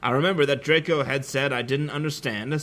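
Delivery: ordinary talk, nothing marked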